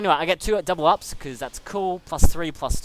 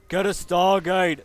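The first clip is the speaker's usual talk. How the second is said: louder and higher, slowed-down yell; panicked retold shout; 5 semitones higher, 7 dB louder, slower than usual. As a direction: call it out as if panicking mid-game.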